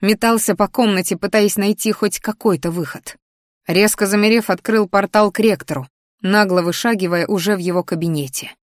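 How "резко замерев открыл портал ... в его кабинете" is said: plain reading voice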